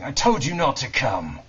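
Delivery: loud, high-pitched angry yell from the crowd; shouting. A narrator's reading. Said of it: "A man's voice was heard shouting".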